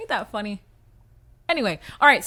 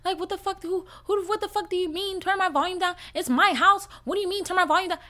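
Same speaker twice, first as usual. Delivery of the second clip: mother blowing up; 8 semitones higher than usual. Retold or imitated. Daughter imitates mom scolding her over the volume, indignant high yell. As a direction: act out mom snapping about her own house. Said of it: "my mother blew up on me".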